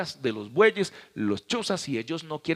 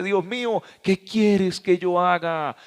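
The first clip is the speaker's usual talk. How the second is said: loud, slow, higher anguished plea to God; pleading cry; 5 semitones higher, 5 dB louder, slower than usual. Acted. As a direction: cry out like a broken man before God. (súplica lenta, alta y fuerte)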